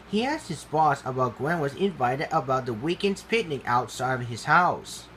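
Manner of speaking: ordinary delivery, nothing marked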